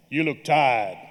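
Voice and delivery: put-on like Darth Vader with a West Indian accent; deep West Indian voice. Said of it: "deep mellifluous, Darth Vader with a West Indian accent voice".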